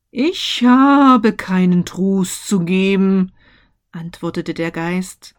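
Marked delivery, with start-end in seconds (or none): 0.1-3.3 ghost voice. A narrator's reading. Ghost answers.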